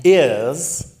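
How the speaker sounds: Ears said slowly and loudly in a mock Edwardian accent; posh yes. Shown as a demonstration. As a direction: make Ears the grandest possible yes. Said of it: "Ears is yes".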